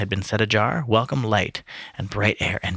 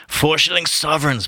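high-pitched, gleeful exclamation; delighted cry; 7 semitones higher than usual. A narrator's reading. Mulligan hears of the four sovereigns, delighted cry. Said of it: "Buck Mulligan cried with delight".

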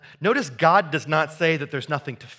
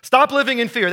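shaming slogan; 8 semitones higher, 8 dB louder than usual. Retold or imitated. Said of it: "That is shaming without assuring".

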